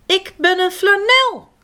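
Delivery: high voiced lisping creature. A narrator's reading. Flanel introduces itself, lisping. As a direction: lisp it sweetly. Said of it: "smisselt het wezen".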